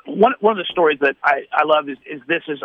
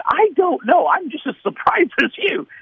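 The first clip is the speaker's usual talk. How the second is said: much higher, half-laughing "I don't know"; tickled and baffled at once; surprised laugh; 11 semitones higher than usual. Retold or imitated. Caller shares friend's amazement.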